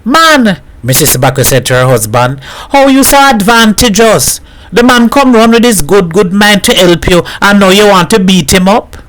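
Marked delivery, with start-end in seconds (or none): 0.0-0.6 high-pitched reproachful cry; protesting. A narrator's reading. Wife rebukes her husband, protesting. 2.7-9.0 high-pitched, reproachful protest; scolding wife. A narrator's reading. Wife defends the helper to her husband, scolding. Voices Missus Baku scolding.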